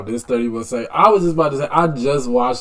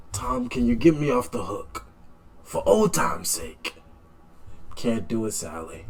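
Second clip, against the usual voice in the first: hushed movie quote; 4 semitones lower, 9 dB quieter than usual. Retold or imitated. Retelling the Godfather line.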